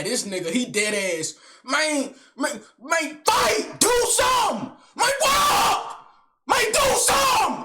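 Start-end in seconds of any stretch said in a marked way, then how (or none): 1.6-7.6 much higher, loud furious yell; screaming. Retold or imitated. Swole uncle screaming to start a fight, loud and much higher.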